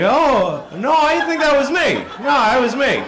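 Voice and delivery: high, rushed backpedalling with a laugh; flustered denial. Retold or imitated. Caught liar backpedalling to the real guy, high and flustered. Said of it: "I was like".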